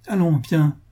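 a touch of imperious impatience. A narrator's reading. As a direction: order him along, brooking no argument. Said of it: "dit-il impérieusement".

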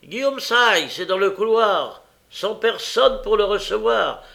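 high, loud, sustained telling-off; exasperated shouting tirade; angry scolding. A narrator's reading. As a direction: berate a dozing servant from afar. Scolding a sleeping servant loudly.

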